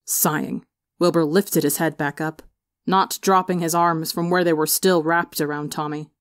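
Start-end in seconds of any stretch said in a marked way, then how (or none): none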